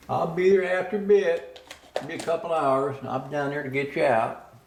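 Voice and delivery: a hint of the landlady promising to come. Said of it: "she says".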